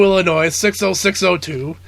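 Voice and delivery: normal talking voice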